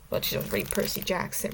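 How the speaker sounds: breathy whispered jab; whispered aside. Real, not acted. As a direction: drop to a snarky whisper. Sneaky snarky aside.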